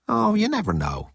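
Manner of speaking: high lilt dropping away; coy leprechaun. A narrator's reading. Hillman dodges Zaphod's doubt, coy high lilt. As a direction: start high and innocent, then fall. Hillman rolls his eye, feigns innocence.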